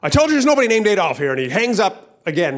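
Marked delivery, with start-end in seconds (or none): 0.0-1.3 fast, loud, high outburst; angry. Acted. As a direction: act out losing your temper on the phone. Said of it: "He's, he's angry".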